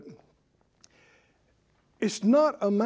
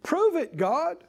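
higher-pitched imitated demand; mock challenge; 7 semitones higher than usual. Preacher voicing people who demand proof from God, challenging. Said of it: "They're asking as a challenge".

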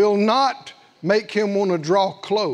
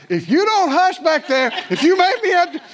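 threatening parent; 9 semitones higher, 4 dB louder than usual. Retold or imitated. Parent scolding kids in car.

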